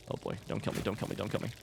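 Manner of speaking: whispered pleading, barely voiced; terrified whisper. Real, not acted. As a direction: beg for your life under your breath. Hiding from monster in game.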